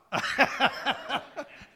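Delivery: real, loud burst of laughter; hearty laugh. Real, not acted. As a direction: laugh out in a quick rolling burst. Laughing as man leaves.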